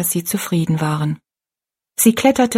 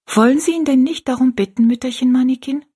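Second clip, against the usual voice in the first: put-on simple child's question; 6 semitones higher than usual. A narrator's reading. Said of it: "fragte Rosalie einfach".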